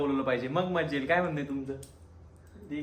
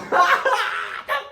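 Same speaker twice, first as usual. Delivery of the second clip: high-pitched burst of laughter; real, high, elated cackle; 4 dB louder than usual. Real, not acted. Fan overjoyed at a dot ball, high laughing.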